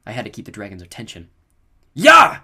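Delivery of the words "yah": very high, loud charging yell; fierce shout; battle cry. A narrator's reading. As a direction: yell as you attack. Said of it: "I charged".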